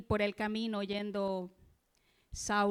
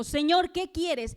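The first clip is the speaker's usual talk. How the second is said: higher, louder and slow, crying out to the Lord; a desperate call to the Lord; urgent cry; 6 semitones higher, 7 dB louder, slower than usual. Acted. Saul's urgent plea to the Lord.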